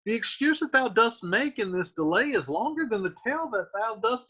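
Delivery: put-on high-pitched impatient young girl; exasperated Juliet. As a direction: fire it back at the stalling nurse. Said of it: "Juliet says".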